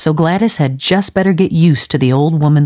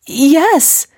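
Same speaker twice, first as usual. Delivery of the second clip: a high, faltering yes; stammered; 12 semitones higher than usual. A narrator's reading. Gladys answering Missus Lawson, high faltering stammer. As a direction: catch on the word, shaken. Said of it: "stammered Gladys".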